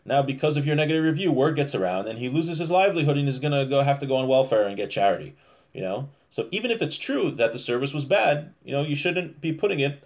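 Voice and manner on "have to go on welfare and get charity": ordinary talk, nothing marked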